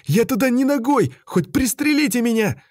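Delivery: high, hysterical fear; losing control in fear. A narrator's reading. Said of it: "Тон Беркута становился истеричным".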